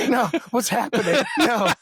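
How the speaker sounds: high, loud, laughing imitation of panic; mock panicked protest. Imitating the displaced French-line recruit.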